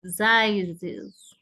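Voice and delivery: "Sizes" in two parts, ending buzzed iz. Shown as a demonstration. Teacher showing plural of size, clear iz ending. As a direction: stretch "Sizes" into a final iz. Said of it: "suena I Z".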